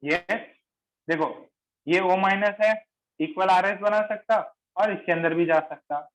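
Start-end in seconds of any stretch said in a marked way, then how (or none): none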